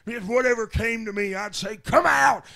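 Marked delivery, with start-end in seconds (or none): 1.8-2.4 loud preacher's rebuke, peaking on come out; full-voiced deliverance shout, rising to the end; shouted exorcism. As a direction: bellow the command at the devil. Commanding the devil out.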